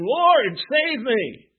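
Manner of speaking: high, loud plea from a sinking man; a panicked cry for help. Acted. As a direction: call out for rescue, high and loud. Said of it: "He cried out".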